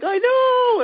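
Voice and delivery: very high hammed-up shriek of a frightened woman; mock shrieking woman. Startled sleeping woman answers the intruder, shrill mock shriek. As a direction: squeal it like a startled stranger. Woman in bed screaming back.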